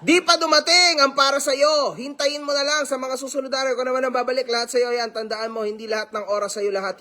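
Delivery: hammed-up high reading; comment read out high. Shown as a demonstration. Reading a fan's comment aloud, high and hammed-up. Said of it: "Ah, hindi, tao to, ha?".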